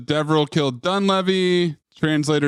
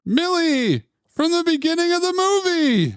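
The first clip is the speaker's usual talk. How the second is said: excited recognition; 12 semitones higher than usual. Real, not acted. Recognising actor, excited outburst.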